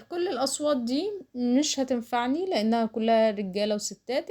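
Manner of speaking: normal talking voice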